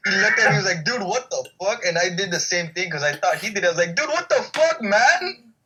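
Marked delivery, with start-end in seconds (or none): 0.8-1.8 mildly incredulous, higher voice; retold disbelief. Friend reacting to food smacked away, mock disbelief. 4.0-5.5 high-pitched, hammed-up disbelief; mock outrage. Joking about smacking his own lunch away, mock outrage. Retold own reaction, exaggerated.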